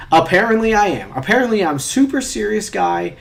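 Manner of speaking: loud, high mock agreement; sarcastic indignation. Real, not acted. Mocking quiz that called them serious, sarcastic indignation. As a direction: throw it out loudly, stung and sarcastic. Bitterly echoing quiz result.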